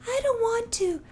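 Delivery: put-on high, soft little-kid whine; whiny kid voice. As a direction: sulk like a small child refusing.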